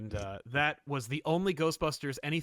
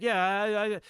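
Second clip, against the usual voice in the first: put-on Italian accent; 6 semitones higher, 4 dB louder than usual. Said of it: "he has that kind of Italian accent".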